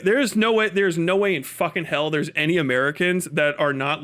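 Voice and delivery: ordinary talk, nothing marked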